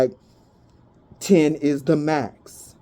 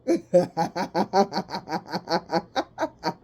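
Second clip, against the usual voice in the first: long laugh; 5 dB quieter than usual. Real, not acted. Laughs at the crying person.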